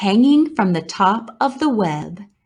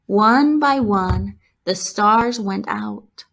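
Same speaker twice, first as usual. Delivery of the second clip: plain reading voice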